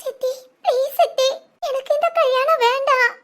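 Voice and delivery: sobbing plea to the stepmother; crying plea. Acted. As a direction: beg through tears not to marry. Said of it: "என்னடி அழுதுகிட்டு இருக்க?".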